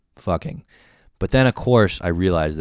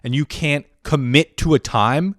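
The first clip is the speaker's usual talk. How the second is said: high, loud exasperated disbelief; retold fury; 5 semitones higher, 5 dB louder than usual. Dungeon master berates friend after all this work, furious. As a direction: build outrage to an emphatic last word. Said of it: "I was so fucking furious".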